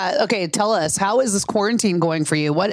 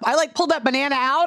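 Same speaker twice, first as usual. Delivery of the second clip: usual speaking voice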